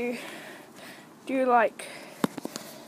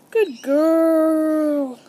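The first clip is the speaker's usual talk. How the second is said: loud, long-held praise to a pet, pitched up; drawn-out praise; 4 semitones higher, 11 dB louder than usual. Real, not acted. Praising guinea pig during training.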